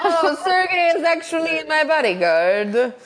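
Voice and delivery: high, slow mock Italian accent; Paolo. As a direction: draw out a hammed-up Italian accent. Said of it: "I can do a little Italian".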